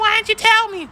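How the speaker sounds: high, put-on accusing squeak; mock partner whining; mock shrill accusing voice. Reenacted quarrel with ex.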